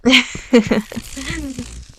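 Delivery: a slow, falling villain's laugh; evil laugh. Acted. Villain laughing down at the bound hunter. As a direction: savour the captive's struggle with a laugh.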